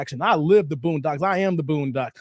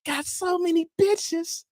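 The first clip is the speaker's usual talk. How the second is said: mock cartoon quote; 10 semitones higher than usual. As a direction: stretch it out high, like a cartoon line. Repeats the Boondocks line.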